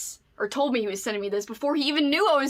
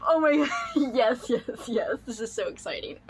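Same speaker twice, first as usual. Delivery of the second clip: real happy tears; teary. Real, not acted. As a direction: voice catching with emotion. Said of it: "I'm getting all emotional".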